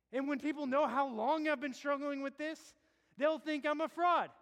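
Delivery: preaching a sinner's panic; rising anguish. Acted. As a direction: build the fear to a cry. Escalating preaching.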